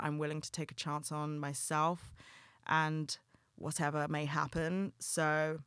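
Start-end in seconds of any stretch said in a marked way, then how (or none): none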